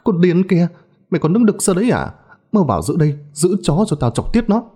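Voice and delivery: high-pitched angry scolding; yelling abuse. A narrator's reading. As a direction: yell orders and curses at a servant girl. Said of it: "gào lên chửi nó".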